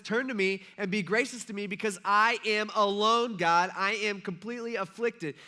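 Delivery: loud, high impassioned appeal; acted lonely plea. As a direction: preach it as a cry for mercy. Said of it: "You ever feel alone?".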